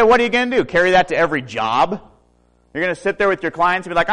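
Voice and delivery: high, loud teasing jab; mocking wife. Wife teasing her husband about carrying a magnifier, in a high, loud mocking voice. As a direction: needle him like a teasing wife.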